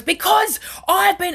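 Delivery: high, loud and drawn out; hammed-up indignant outburst; retold angry yell. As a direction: imitate an old lady yelling back. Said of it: "why are you so angry while you yell that".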